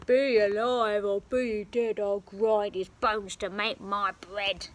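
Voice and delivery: booming giant. Acted. Giant threatens.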